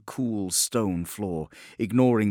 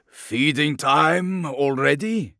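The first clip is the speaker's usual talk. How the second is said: slow, sarcastic and louder; mocking greeting; 6 semitones higher, 5 dB louder, slower than usual. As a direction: sneer it with a smile. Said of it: "greets his captors with a smile".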